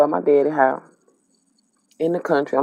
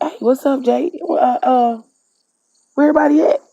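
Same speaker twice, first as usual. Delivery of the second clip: excited greeting; 9 semitones higher, 4 dB louder than usual. Retold or imitated. Her greeting, calling out.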